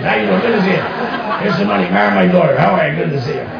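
lightly imitated Greek accent. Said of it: "I talk like that for the rest of the cruise".

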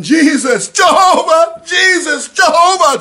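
high, loud desperate shouting; screaming names. Retold or imitated. Running from demons, screaming for Jesus, loud and high. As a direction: cry the names out at the top of your voice. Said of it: "I was screaming".

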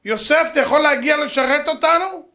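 long drawn-out high call for Yosef. Acted. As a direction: call him from across the hall, high and loud. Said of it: "והיא קוראה לו".

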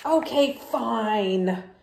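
loud, slow, huffy giving in; grudging pigeon. Acted. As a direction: drag it out like a sulky kid.

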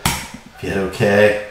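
ordinary delivery

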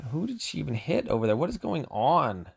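can't believe what just happened; exasperated disbelief. Real, not acted. Gamer baffled at his ally's move, exasperated disbelief. Ally's unit dies unexpectedly in match.

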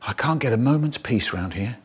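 ordinary delivery

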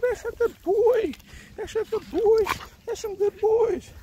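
very high sing-song praise for a dog; talking to a dog. Real, not acted. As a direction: gush over the dog in a high voice.

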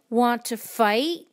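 loud, slow, rising challenge; grouchy challenge. A narrator's reading. Grouchy ladybug picking a fight, loud rising challenge.

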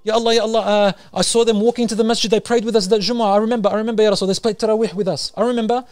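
high, loud, urgent begging; desperate pleading. Acted. Believers pleading with Allah to save their mates, high and loud. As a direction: beg frantically for your friends. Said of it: "they are pleading to Allah".

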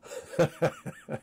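breathy laugh at himself; nervous laugh. Real, not acted. Laughs at own rant.

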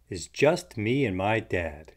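ordinary delivery, nothing marked

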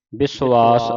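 ਵਿਸ਼ਵਾਸ loud, said bi-su-aas with a vowel for the ਵਾਵਾ; correct pronunciation. Shown as a demonstration. Teacher spelling it out for the student, correct pronunciation. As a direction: model ਵਿਸ਼ਵਾਸ firmly as bi-su-aas. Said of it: "ਬੱਬੇ ਸਿਹਾਰੀ ਸੱਸੇ ਔਂਕੜ ਐੜੇ ਕੰਨਾ ਸੱਸੇ".

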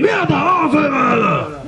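high-pitched, strained sergeant's yell; imitated yelling. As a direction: bellow like an outraged drill sergeant, without full volume. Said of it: "למה לצעוק?".

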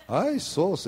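high exclaiming Filipino doctor; doctor's exclamation. Retold or imitated. The Filipino doctor reacting to the chart, high exclaim. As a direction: mimic the doctor's surprised cry. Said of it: "He said".

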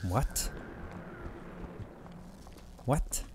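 ordinary talk, nothing marked

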